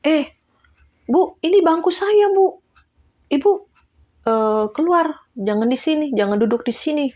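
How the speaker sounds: high, loud indignant shooing; mock angry order. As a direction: shoo her off the seat, loud and high. Angry participant ordering seat vacated. (usiran ketus bernada tinggi)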